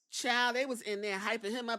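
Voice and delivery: normal talking voice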